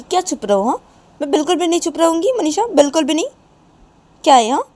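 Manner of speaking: very loud, high-pitched refusal; defiant outburst. Acted. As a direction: refuse hotly at full voice. Nisha defiantly refuses to be quiet.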